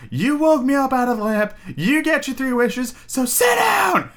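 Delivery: high, screaming genie voice; Robin Williams genie scream. Retold or imitated. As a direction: shriek it, building to a yell. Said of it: "And he just fucking screams".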